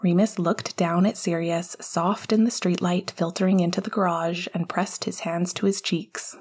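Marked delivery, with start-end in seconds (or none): none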